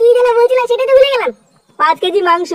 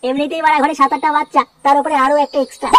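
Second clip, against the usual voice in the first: much lower, grumbling man voice; grumpy low voice of an overburdened husband griping about money; grumbling poor father; 9 semitones lower than usual. Acted. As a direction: mutter complaints as a broke father of many kids. Grumbling character voiced in cartoon.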